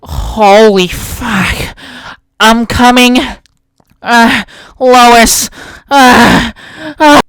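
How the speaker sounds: loud, cartoonish voice, slow start; Peter Griffin parody. Bullied kid answering, in a loud cartoon dad voice. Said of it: "listen to how he responded".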